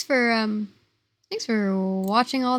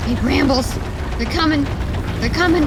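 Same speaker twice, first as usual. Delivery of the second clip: loud alarmed calls; panicked shouting; 4 semitones higher, 6 dB louder, faster than usual. Real, not acted. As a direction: warn them, loud and frantic. Shouting warning in game.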